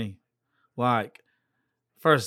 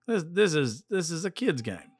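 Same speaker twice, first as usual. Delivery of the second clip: put-on "kid's game" repeated higher and stressed; emphatic repeat; 5 semitones higher than usual. Shown as a demonstration. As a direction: raise the pitch to drive home "kid's game".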